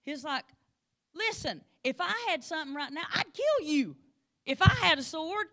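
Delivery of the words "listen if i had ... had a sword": louder, higher angry threat; mock angry threat. Balaam threatening his donkey, mock angry. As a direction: threaten the donkey like a fuming rider. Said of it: "He was so mad".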